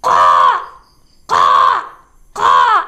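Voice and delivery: rough, low crow caws, "കാ" three times; hoarse crow call on each "കാ"; crow cawing. Shown as a demonstration. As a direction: caw "കാ" harshly like a real crow. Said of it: "ശരിക്കും എങ്ങനെയാ ഗുറാബിന്റെ ശബ്ദം".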